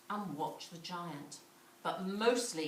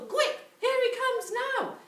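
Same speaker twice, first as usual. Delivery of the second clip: high, loud panicked cry; urgent alarm; 8 dB louder than usual. A narrator's reading. Giant's wife hurrying Jack to hide, high and urgent. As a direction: hurry him, giant's coming.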